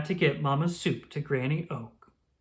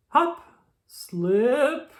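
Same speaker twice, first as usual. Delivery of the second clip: high, louder hop then slip; sound-effect hop and slip; 9 semitones higher, 5 dB louder than usual. Acted. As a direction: bounce up then skid. Hopping sound words.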